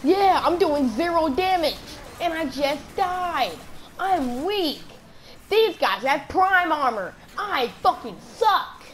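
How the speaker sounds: slow, high exasperated wail ending on a punched FUCKING SUCK; over-emphatic cursing. Real, not acted. Gamer lamenting his zero damage, slow emphatic complaint with FUCKING stressed. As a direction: lean hard on every word, "fucking" most of all. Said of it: "That was a little bit too much, uh, emphasis".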